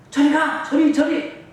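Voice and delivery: loud, high-pitched dismissive shout; shooing away. Acted. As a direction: shoo the child off loudly. Disciples shooing a child away.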